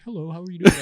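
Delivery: polite parent voice. Retold or imitated. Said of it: "they talk to their parents and they're like".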